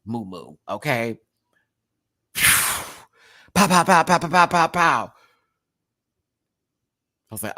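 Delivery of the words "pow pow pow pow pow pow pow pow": punchy pow pow pow, louder; mock punch sounds. Reliving the fight scene, punchy pow sounds. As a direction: fire off the pows like comic-book hits.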